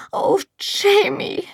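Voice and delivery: slow, high whispered plea; Oh, Jamie whispered high and slow in despair. A narrator's reading. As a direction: break into a breathy whisper of his name. Despairing plea to her husband.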